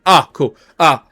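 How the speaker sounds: high, loud brushing it off; change the subject fast; Bong's voice. Acted. Bong dodging questions and asking for Gift Quinn. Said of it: "He's like".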